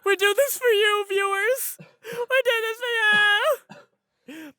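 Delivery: high, loud, hammed-up wail; mock anguished shout. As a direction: cry out like a martyr to the fans. Mock martyr cry to viewers.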